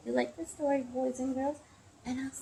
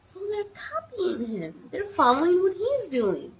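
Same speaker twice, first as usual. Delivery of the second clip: high-pitched storytime amazement; amazed realisation; 9 semitones higher, 4 dB louder than usual. Acted. Storyteller to children as monkeys copy him, amazed. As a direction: explain it high, as a sudden discovery. Narrator marvels at the copying monkeys.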